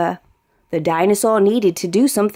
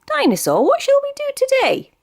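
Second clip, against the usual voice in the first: put-on high, quick little boy voice; a small boy asking eagerly; bright childlike question; Danny's voice; faster than usual. A narrator's reading. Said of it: "Danny said".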